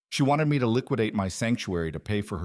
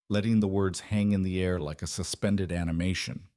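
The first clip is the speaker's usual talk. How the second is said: plain reading voice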